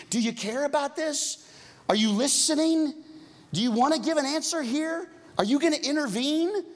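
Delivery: high, urgent pleading questions; pleading to God. Retold or imitated. Pastor voicing a desperate prayer, high and pleading. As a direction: cry out to God, demanding an answer.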